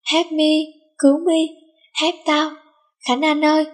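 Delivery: high, pleading call for help; cry for help. A narrator's reading. Minh begging Khánh Anh to save him from the beating, high and pleading. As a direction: squeal for rescue like a beaten kid.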